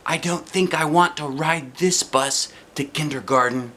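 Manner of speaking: put-on a light child voice begging not to go; Junie B pleading. A narrator's reading. Girl telling her mother she won't ride, lightly pleading. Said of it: "I told Mother again".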